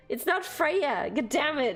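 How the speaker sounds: ordinary talk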